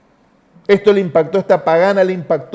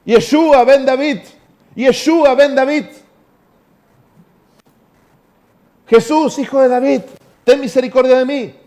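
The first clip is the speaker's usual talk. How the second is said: crying out; 5 semitones higher, 3 dB louder than usual. Retold or imitated. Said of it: "empieza a gritar".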